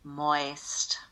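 breathy whisper of moist. Shown as a demonstration. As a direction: whisper the word moist slowly. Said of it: "And I'll say it again".

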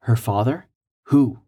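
plain reading voice

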